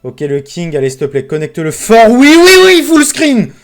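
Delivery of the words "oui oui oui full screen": very high, loud yeses; jubilant shout. Real, not acted. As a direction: shout it out as the jackpot lands. Full screen win on the slot. (cri de joie aigu)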